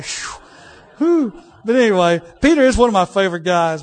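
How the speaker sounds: big relieved whew, then loud; preacher relieved after teasing pastor; loud relief. Real, not acted. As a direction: exhale loudly and carry on, laughing at himself. Relieved laugh-like exclamation.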